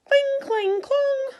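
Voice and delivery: high, loud metal clang sounds; clashing swords. Acted. The knights' swords clashing in battle, high loud clangs.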